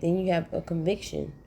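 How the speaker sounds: normal talking voice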